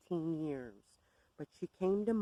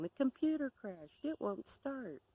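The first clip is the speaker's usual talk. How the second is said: higher mock panic yell, fading at the end; imitated screaming; 6 semitones higher than usual. Bev calling in a panic about the crash, higher mock scream. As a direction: blurt it out high and panicked. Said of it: "she calls me screaming".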